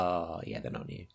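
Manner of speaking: low, soft deflated admission; quiet realisation. Retold or imitated. Runner admitting to herself the shoes are old, quiet realisation. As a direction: drop it low and small, as if just realising.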